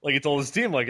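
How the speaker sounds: ordinary delivery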